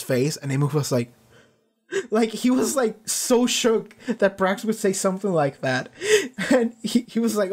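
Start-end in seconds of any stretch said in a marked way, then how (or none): 5.9-6.4 a bright, high burst of laughter; a genuine laugh in a high voice; real laugh. Real, not acted. Laughing at Amos's shocked reaction.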